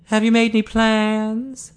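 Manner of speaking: high, slow, concerned woman's voice; sympathetic Eileen. A narrator's reading. Eileen gently asking bereaved Ted about plans, sympathetic. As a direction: ask it slowly and high, with sympathy. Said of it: "Her sympathy was genuine".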